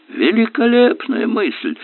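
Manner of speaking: high, slow delighted exclamation; exclaiming. Acted. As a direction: cry it out with delight. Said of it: "воскликнул окружной прокурор".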